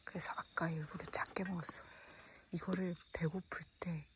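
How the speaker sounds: soft unvoiced whisper. Real, not acted. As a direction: hush it so nobody wakes. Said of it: "목소리 크게 안 내서 돼서 좋다".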